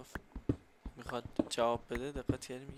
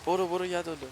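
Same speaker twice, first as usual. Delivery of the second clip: loud, high angry yelling; angry shouting; 4 semitones higher, 8 dB louder than usual. Real, not acted. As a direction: yell at him to give it back. Demanding bed back in game.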